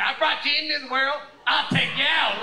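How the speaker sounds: high, loud imitation of a mother; hammed-up motherly threat; threatening mother. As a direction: threaten like a mock-furious mom.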